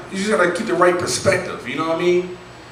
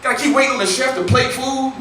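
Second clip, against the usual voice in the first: fast mock whining, higher; whiny complaining; 10 semitones higher than usual. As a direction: complain breathlessly about the cafeteria.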